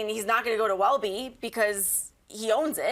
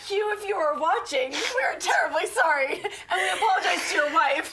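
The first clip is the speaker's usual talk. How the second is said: laughing; 7 semitones higher than usual. Real, not acted. Mock apology while laughing.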